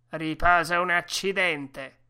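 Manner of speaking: loud, irritated oath; cursing. A narrator's reading. Driver rejecting rest for his dogs, loud angry curse. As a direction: spit out a loud dismissive curse. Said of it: "nel sentire quell'imprecazione".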